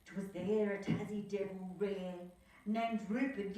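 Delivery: usual speaking voice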